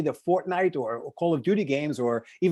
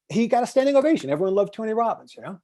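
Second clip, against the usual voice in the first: ordinary talk, nothing marked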